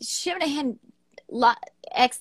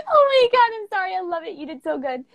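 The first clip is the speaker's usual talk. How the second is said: high, teary laughing through the words; laughing hard; 7 semitones higher, 3 dB louder than usual. Real, not acted. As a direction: talk while still shaking with laughter. Laughing fit after the poem.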